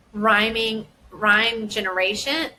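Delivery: usual speaking voice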